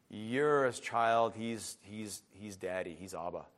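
low, very soft, slow declaration; reverent, dropped voice; hushed reverence. Real, not acted. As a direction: let each title sink in quietly. Reverent, hushed close on who God is.

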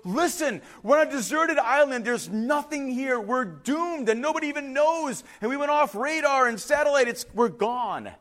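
high-pitched, desperate pleading; acted freaking out. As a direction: shake sense into your friend. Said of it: "the first guy's all freaking".

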